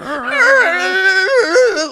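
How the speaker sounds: very high, loud sustained screech; imitated car screech. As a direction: screech like a car dragged on its brake. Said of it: "heard the car the whole way going".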